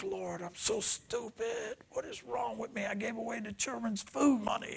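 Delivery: a touch of self-pity, hushed and strained; retold crying prayer. Preacher retelling his tearful prayer in the car, mildly tearful. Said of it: "I'm crying".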